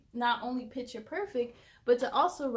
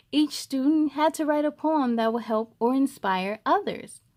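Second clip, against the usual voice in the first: lively read-aloud voice, a bit louder; storybook reading; 2 semitones higher, 9 dB louder than usual. A narrator's reading. Reading a picture book aloud to young viewers, lively storytelling. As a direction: read it to a group of kids.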